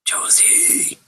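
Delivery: faint whispered name call. Acted. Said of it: "in a faint whisper".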